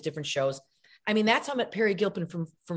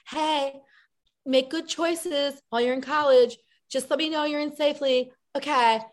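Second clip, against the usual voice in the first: high, singsong mom voice; parent on phone; 6 semitones higher than usual. Acted. Mom calling her college kid, put-on high cheery check-in.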